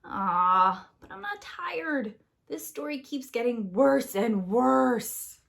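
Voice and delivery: slow whine; whiny hungry Jack. Acted. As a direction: plead for food. Jack voiced in story.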